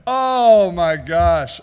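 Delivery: big loud "oh", dropping in pitch; loud exclamation. Real, not acted. Host recovering from laughter, exclaiming loudly. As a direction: let it burst out after the laughing fit.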